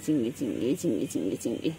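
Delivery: calling the pet in a low, hushed voice; low soft name call. Real, not acted.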